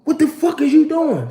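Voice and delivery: high, sharp demand; angry valet shout. Retold or imitated. Valet yelling at the driver backing out, an angry high demand. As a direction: bark it at the driver. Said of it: "So he like".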